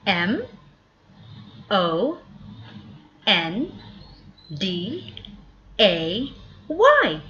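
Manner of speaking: Monday spelled M O N D A Y, each letter separate with pauses between; spelling aloud. Shown as a demonstration. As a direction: spell it out slowly for the kids. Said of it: "Can you spell Monday?".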